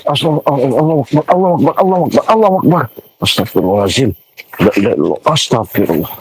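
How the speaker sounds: rapid repeated cries, pitch climbing; panicked invocation. Real, not acted. Cries to God at a sudden scare, panicked. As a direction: cry out to God in sudden alarm.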